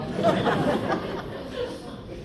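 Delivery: airy high laugh; laughing at the punchline; breathy laugh. Real, not acted. Preacher laughs at the old women's retort.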